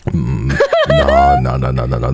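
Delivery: usual speaking voice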